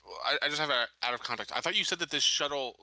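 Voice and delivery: ordinary talk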